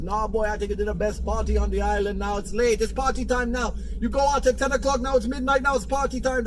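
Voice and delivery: put-on high, insistent Jamaican accent. Friend insists it's party time, Jamaican accent. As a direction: imitate a Jamaican friend, pitch climbing as he insists. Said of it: "W Andrew Tate's Jamaican accent".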